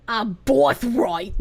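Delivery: slow, grand ad voiceover; inspirational read. Pretend. Streamer reading a quote like a Hello Fresh inspirational ad.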